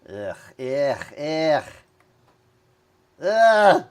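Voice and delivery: ughs building to a loud groan; annoyed ugh. Real, not acted.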